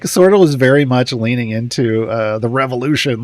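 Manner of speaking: normal talking voice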